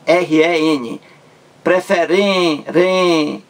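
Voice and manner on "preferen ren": "Preferen-" with long high "ren", then "ren" alone; stress on REN. Shown as a demonstration. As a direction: stretch and repeat the "ren" of "Preferen-". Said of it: "ren é a sílaba mais forte". ("Preferen-" destacando e alongando o "ren")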